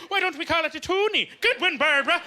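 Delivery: high-pitched chirpy suburban mom impression; soccer mom. Soccer mom pitching a coin name, mock high voice. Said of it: "forty-five-year-old soccer mom from Cape Breton".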